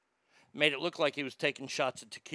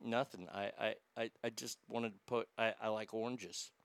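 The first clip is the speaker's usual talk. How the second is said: acted timid, low and soft; meek stammered reply; 6 semitones lower, 11 dB quieter than usual. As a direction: shrink back, quietly stumbling over an excuse. Meek answer to the attack.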